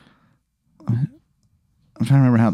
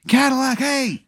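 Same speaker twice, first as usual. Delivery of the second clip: very high, held-back shout; mock yell; 14 semitones higher, 5 dB quieter, slower than usual. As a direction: imitate yelling to a distant car, high but soft. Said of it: "and I yell".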